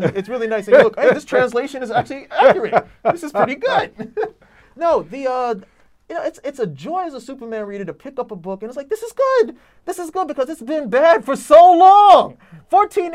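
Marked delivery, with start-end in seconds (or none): 1.0-4.3 pleased, laughing as he reads it out; amused surprise at a good translation; delighted laughing reader. Retold or imitated. 8.9-12.4 much higher and louder, slow gushing praise; overjoyed exclaim. Retold or imitated.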